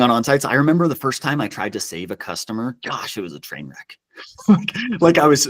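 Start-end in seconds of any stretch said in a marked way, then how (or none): 4.5-5.4 high, loud laughing word. Real, not acted. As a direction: break into a laugh as you start. Laughs recalling his train wreck.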